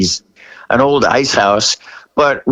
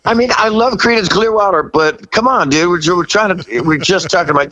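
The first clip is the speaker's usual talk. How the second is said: normal talking voice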